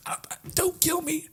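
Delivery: high, quiet strangled plea; imitating being choked. Dog getting choked, high soft mock-strangled plea. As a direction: plead faintly as if choked. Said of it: "He gets choked and he's like".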